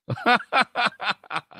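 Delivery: a sudden high burst of laughter. Real, not acted. Preacher laughing at being dropped from the pulpit, high laugh.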